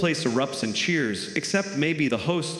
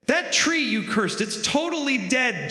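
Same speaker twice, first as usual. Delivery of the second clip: high, amazed tattletale voice; mock Peter; 10 semitones higher than usual. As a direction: point out the obvious, pitched way up. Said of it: "Peter ... says".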